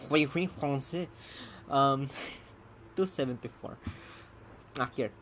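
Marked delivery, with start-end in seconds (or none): 0.1-1.1 mock French. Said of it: "the more French I get".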